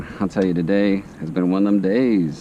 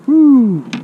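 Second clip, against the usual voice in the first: relieved whew; 13 semitones higher, 9 dB louder than usual. Real, not acted. Relief after landing fish.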